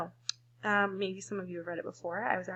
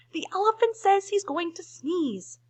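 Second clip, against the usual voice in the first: calling out a warning; 11 semitones higher, 6 dB louder than usual. Acted. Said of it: "called the birds to the bees".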